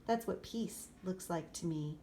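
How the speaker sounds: ordinary delivery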